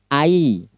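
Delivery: "I" said as a gliding ai diphthong; diphthong demo. Shown as a demonstration. Teacher demonstrating the ai diphthong to students. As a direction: sound out "I" as ai for the class. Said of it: "cara bacanya seperti itu".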